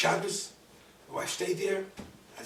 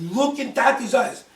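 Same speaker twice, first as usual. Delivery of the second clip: loud, higher, slow command; commanding call; 5 semitones higher, 12 dB louder than usual. Retold or imitated. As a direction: call out forcefully, stretching the words. Repeats command to screaming daughter.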